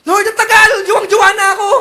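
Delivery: high, loud mock shouted complaint; whiny impatient teen. Teen complaining to God about waiting for a partner. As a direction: wail like a lovesick teenager.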